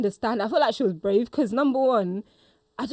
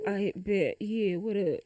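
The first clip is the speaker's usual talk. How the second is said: put-on low-key, softly spoken boyfriend; Julius; 4 semitones lower, 8 dB quieter, slower than usual. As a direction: play it cool and quiet on the phone. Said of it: "So you call, you call me".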